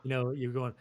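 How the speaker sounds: ordinary talk, nothing marked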